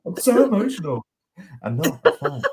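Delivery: high, loud mock-tearful wail, hammed up; mock emotional. Guest with a cold jokingly hams up being emotional. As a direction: pretend to be all choked up as a joke. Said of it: "They'll think I'm emotional".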